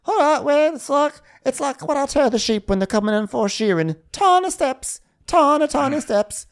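very high, hammed-up mum; put-on mother voice. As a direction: squeak out homely advice to a son. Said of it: "And she's like".

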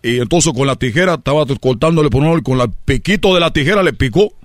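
ordinary delivery

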